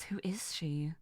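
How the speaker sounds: breathless whisper; hushed, impressed whisper; awed whisper. Real, not acted. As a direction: whisper it in amazement. Awed at her own villain voice.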